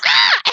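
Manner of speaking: put-on girl startled from behind by someone sneaking up; startled scream. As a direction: jump out of your skin with a yell. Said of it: "She just screams".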